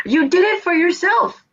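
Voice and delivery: high mocking scold, taunting the wrestler. Mocking Rollins after match.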